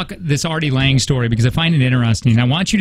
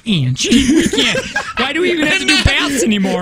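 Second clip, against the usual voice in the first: dad giving up on bath time amid screams; laughing exasperated dad; 11 semitones higher than usual. Retold or imitated. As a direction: plead through laughter with a screaming kid. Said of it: "I go".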